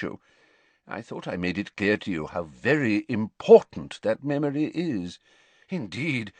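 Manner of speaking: controlled reproach, emphasis building; Dumbledore, cold disappointment. A narrator's reading. Headmaster reproves pupil over the crucial memory, coldly disappointed. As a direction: reprove firmly without sounding angry. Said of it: "Dumbledore had not raised his voice. He did not even sound angry".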